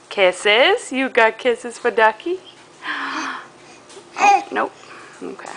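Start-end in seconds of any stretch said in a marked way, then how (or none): none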